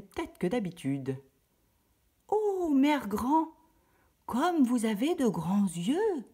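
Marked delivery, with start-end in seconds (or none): 2.3-6.2 much higher, slow amazed voice; wondering little girl. Acted. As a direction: marvel at grandma's big eyes. La fillette s'étonne. (étonnement lent et aigu de la fillette)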